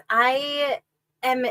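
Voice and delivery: ordinary talk, nothing marked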